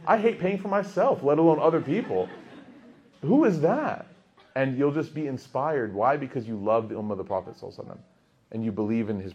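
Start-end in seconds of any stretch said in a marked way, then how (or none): 0.1-4.1 high, laughing at your own stinginess; half-laughing mock confession, getting louder; laughing inner voice. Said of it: "And you're like".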